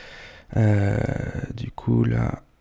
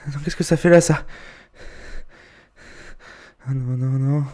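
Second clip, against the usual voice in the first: panicked; 5 semitones higher, faster than usual. Acted. Panicking at a strange noise.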